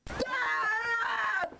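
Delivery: piercing high screaming laugh; high shriek. Acted. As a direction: let out a wild screech. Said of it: "The best scream in the game right there".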